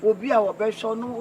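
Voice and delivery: normal talking voice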